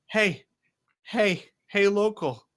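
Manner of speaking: high, insistent hey to rouse a sleeper; waking someone up. Acted. Hart smacking sleeping Levine to wake her, insistent calls. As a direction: call sharply to wake them.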